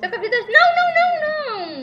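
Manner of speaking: high, loud desperate cries; panicked shouting. Real, not acted. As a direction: shriek as you go down. Dying in game.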